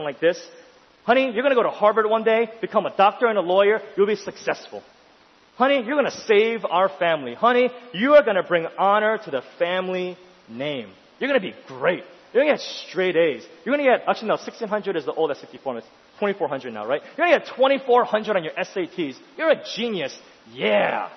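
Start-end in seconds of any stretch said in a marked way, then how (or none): 1.0-14.1 raised, emphatic voice of a gushing parent; imitated proud parent. Parent promising honey a Harvard future, imitated gushing pride. 17.2-21.1 raised, emphatic voice of a gushing parent; imitated proud parent. Parent promising honey a Harvard future, imitated gushing pride.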